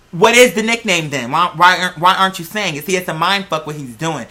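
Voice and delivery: ordinary talk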